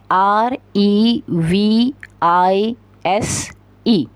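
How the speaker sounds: slow letter by letter: आर ई वी आई एस ई; spelling out letters. Shown as a demonstration. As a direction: spell आर ई वी आई एस ई out slowly, one letter at a time. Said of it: "अब इसकी spelling क्या है?".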